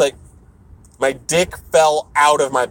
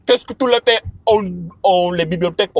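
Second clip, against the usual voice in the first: higher, lightly put-on French voice; bubbly French; 6 semitones higher than usual. Speaker playing at French phrases, lightly bubbly. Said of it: "when I talk another language".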